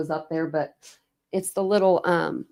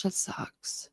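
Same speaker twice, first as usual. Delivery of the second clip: soft breathy whisper, much softer; 13 dB quieter than usual. Real, not acted. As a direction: breathe it out under your breath. Sympathetic aside.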